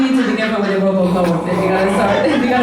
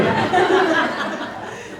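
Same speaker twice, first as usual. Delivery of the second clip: high breathy burst of laughter; 6 semitones higher, 4 dB quieter than usual. Real, not acted. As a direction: laugh at your own joke. Laughs at her own analogy.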